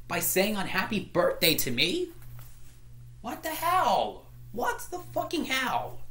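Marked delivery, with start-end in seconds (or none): 3.2-6.0 outraged disbelief. Real, not acted. To Boron over the ruined birthday, outraged and high.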